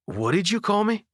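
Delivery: shocked question. A narrator's reading. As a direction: hit it high, like you can't believe what you heard. Shocked at being misnamed.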